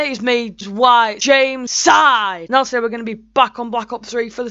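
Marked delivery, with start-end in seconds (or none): 0.5-2.5 drawn-out name. Real, not acted. Announcing own name in intro.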